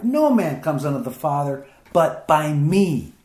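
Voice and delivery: usual speaking voice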